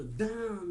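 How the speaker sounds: storyteller doing a loud bang sound; sound effect. Acted. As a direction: punch out the impact noise. Sound effect in dirty joke.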